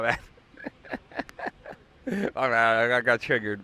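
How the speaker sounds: chuckling through the words; real embarrassed laugh after an outburst; laughing at himself. Real, not acted.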